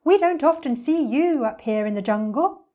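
high, lilting bird voice; singsong bird. Acted. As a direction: chirp it out sociably.

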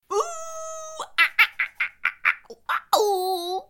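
high monkey hoots and cries; monkey speak. Acted. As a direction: hoot like a monkey calling its troop. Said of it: "speaking monkey".

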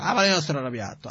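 irritated denial. Retold or imitated. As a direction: brush him off fast, annoyed. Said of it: "che parlava in un modo questo".

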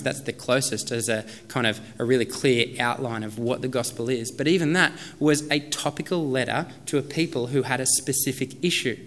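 usual speaking voice

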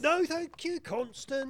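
high, strained politeness; testy refusal. Acted. Inspector refuses housekeeper's news of rival detective, testy. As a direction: cut off the offer testily.

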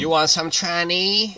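slow, creepy mock Brazilian accent. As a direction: lean in like a shady Brazilian stranger.

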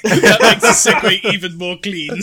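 giggling through the words; laughing. Real, not acted. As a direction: talk while cracking up. Laughing at the joke.

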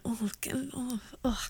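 hushed oh God of embarrassment; horrified whisper. Retold or imitated. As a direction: whisper it like you can't bear the thought. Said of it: "I'm like".

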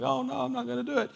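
high, soft mimicked protest; quick high-pitched denial, played lightly; imitating someone brushing it off; mock protest.

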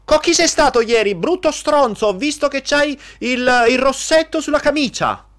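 high, loud accusing yell at a cheating partner; jealous angry partner. Acted. Partner accusing him about lipstick on his shirt, high angry yell. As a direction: hurl the accusation like a furious jealous girlfriend.

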